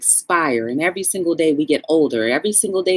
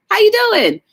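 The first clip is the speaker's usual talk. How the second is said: excited hello; 6 dB louder than usual. Real, not acted. Greeting a viewer in chat.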